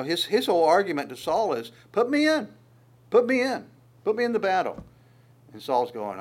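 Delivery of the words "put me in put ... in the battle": higher, eager put me in; eager plea. Retold or imitated. David begging Saul, high and eager. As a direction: beg to be sent in.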